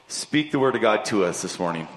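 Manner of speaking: ordinary talk, nothing marked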